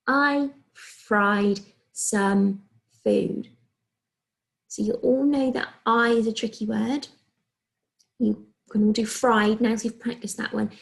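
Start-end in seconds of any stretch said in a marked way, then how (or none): 0.1-3.5 each word drawn out very slowly; slow dictation. Shown as a demonstration. Teacher slowly dictating a sentence to year one. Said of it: "teacher dictates slowly". 8.2-9.8 unvoiced whispered aside. Shown as a demonstration. Teacher whispering encouragement to the class. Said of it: "teacher whispers aside".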